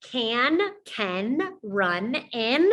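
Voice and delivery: slow with a rising question tune. Shown as a demonstration. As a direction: read it slowly as a question, rising at the end. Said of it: "That was a question".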